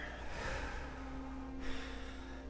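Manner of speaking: long airy exhale after rushing; breathless sigh. Real, not acted. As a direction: blow out a tired breath. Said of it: "Let me just catch my breath here".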